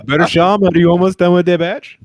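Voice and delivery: hammed-up yell across the lab; mock cauldron call. Joking about a drug-mixing cauldron.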